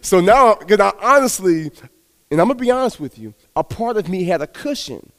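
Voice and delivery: ordinary delivery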